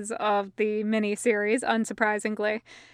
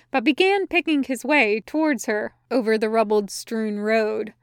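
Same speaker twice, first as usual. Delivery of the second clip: ordinary delivery, nothing marked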